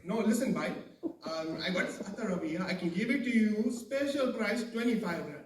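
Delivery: insistent Pakistani vendor; Pakistani attar seller. Acted. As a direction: coax a reluctant buyer. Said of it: "this Pakistani guy trying to sell".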